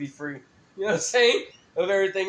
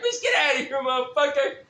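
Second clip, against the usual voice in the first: laughing mock dismissal; 7 semitones higher, 4 dB louder than usual. Laughing at the guy.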